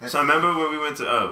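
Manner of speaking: usual speaking voice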